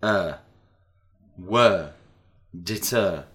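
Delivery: each word said carefully and clearly; teacher modelling the sound E; model short e. Shown as a demonstration. Teacher models letter E words for repetition. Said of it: "Letter E and sound".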